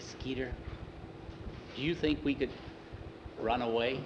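softly, hesitantly, with pauses; hushed suggestion. A narrator's reading. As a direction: float a secret plan under the breath. Boys secretly plan to run away.

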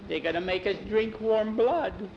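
high voice, rising dread; horrified revelation. A narrator's reading. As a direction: let horror climb through the line. Bowie reveals frightening rumour.

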